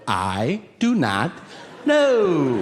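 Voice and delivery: slow, NOT and know spelled out; pointed slow denial; deliberate. Retold or imitated. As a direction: draw each word out.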